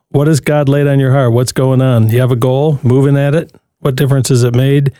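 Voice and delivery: ordinary talk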